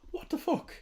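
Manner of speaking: whispered in disbelief; stunned whisper. Real, not acted. Streamer to himself, a stunned whisper. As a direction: breathe it out under your breath.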